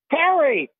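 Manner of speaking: calling out high across the house; wife yelling for her husband; screaming for Harry. Retold or imitated. Wife calls her husband to the phone, high yell. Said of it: "she just started screaming".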